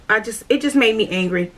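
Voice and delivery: normal talking voice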